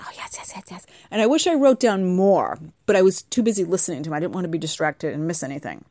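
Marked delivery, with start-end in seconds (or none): 0.0-0.9 breathless whispered yeses; eager whisper. Retold or imitated. Podcaster answering the curious officer in an eager whisper. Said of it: "I said".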